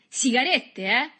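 high, loud taunt; triumphant cry. A narrator's reading. Boy waves captured cigarettes, crying out tauntingly. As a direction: call it out as a gloating question. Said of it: "gridò".

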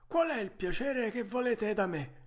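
vocina acuta da vecchio falegname; mastro falegname. Acted. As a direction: rispondi in tono molto acuto, da anziano artigiano. (vocetta alta del falegname)

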